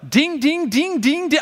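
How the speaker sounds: high, lively sung dings; cheerful bell ding imitation. Pastor imitating Mrs Claus ringing two bells, high and bright. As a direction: ring out the dings like happy bells. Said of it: "she was like".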